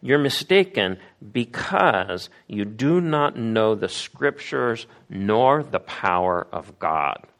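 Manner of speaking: slow, deliberate words; measured, solemn correction; slow rebuke. Retold or imitated. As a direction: spell out the charge word by word. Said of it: "look at how he says they're mistaken".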